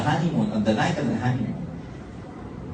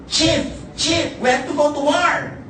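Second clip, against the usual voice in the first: much higher, urgent call to the chief; right-hand man calling; 13 semitones higher, 4 dB louder than usual. Retold or imitated. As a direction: cry out to the chief in alarm. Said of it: "his right-hand man approached and said".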